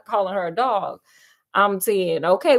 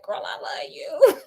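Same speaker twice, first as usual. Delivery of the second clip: mock whisper; 5 semitones higher than usual. Husband whispering sweet talk.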